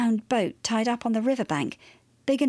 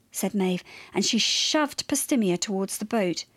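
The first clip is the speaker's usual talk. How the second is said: ordinary delivery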